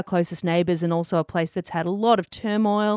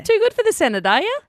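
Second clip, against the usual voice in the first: mock haughty tease; 6 dB louder than usual.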